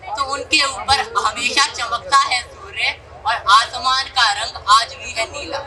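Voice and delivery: normal talking voice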